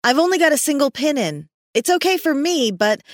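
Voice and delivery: high-pitched called-out warning; yelling. A narrator's reading. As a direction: call down to the climber below. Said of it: "she yelled".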